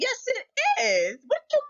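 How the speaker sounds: rising indignant cry; indignant outburst. Real, not acted. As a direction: burst out in disbelief at them. Indignant retort to doubters.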